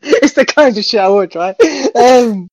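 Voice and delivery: loud, laughing confession; laughing admission. Real, not acted. Co-host laughing as he admits the daydream. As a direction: own it through a laugh.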